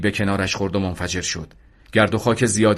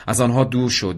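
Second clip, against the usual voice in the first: ordinary delivery, nothing marked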